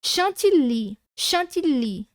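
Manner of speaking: chantilly with the Y as a full I vowel; teacher repeating chantilly; demo pronunciation twice. Shown as a demonstration. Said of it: "eu coloquei pra vocês, ó".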